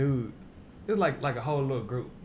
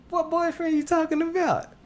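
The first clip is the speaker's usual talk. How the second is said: much higher, loud, feigned innocence; high mock innocent voice; 7 dB louder than usual. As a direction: play dumb in a high voice. Girl's retold reply, high.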